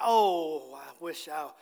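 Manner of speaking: low, hushed regretful thought; a mild groaning "Oh", then sinking quiet; inward regret. Retold or imitated. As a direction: mutter it as a rueful afterthought. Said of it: "we're thinking".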